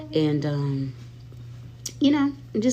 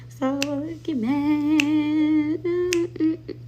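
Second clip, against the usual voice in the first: mocking drawl; 10 semitones higher, much slower than usual. Real, not acted. Mocking haters, drawn out.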